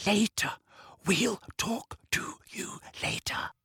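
slow, voiceless stage whisper; exaggerated whisper across the hall; mouthed whisper. A narrator's reading. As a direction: mouth each word separately with barely any voice. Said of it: "she mouthed to Ernie and Hannah".

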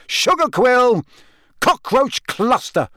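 high yelled guesses, Cockroach stretched and slow; angry yell. A narrator's reading. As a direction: fling passwords out in frustration. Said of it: "he yelled angrily".

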